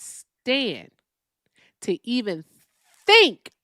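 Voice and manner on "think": sudden much higher, louder burst on one word; exasperated outburst; emphatic yell. Real, not acted. Ranting, emphatic repetition.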